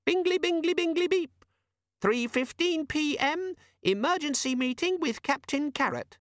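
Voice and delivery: chirp, then read the diary out; chirpy gadget chime. Acted. The demon announcing Vimes's next appointment, squeaky.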